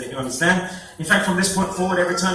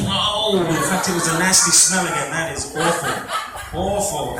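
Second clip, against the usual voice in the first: ordinary talk, nothing marked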